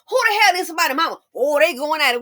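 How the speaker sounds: fast, high, loud nosy mother; nosy mama. Retold or imitated. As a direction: imitate the eavesdropping mother. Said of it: "let somebody mama up in there".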